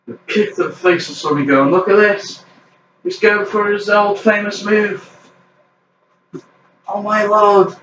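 high-pitched play-by-play of each move; excited wrestling commentary. Pretend. Commentator announcing his old famous move, excited play-by-play. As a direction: build up the next big move.

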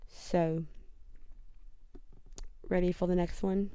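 put-on amateur London accent, done for fun; playful, imperfect London accent. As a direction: talk like a Londoner out of Harry Potter. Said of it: "a London accent".

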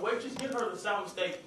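normal talking voice

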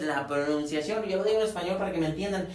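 usual speaking voice

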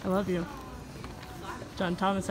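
usual speaking voice

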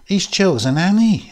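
put-on high, exclaiming chorus of girls; girls' chorus. A narrator's reading. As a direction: call it out high, as a group would. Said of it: "said the girls in chorus".

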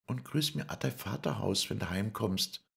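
high and tender farewell; Lisei's voice. Acted. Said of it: "sagte Lisei".

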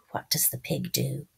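hushed whispered question. Acted. Quiz whisper to children.

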